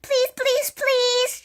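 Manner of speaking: high, loud repeated plea; shouting. A narrator's reading. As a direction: plead at the top of a high voice. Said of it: "she shouted".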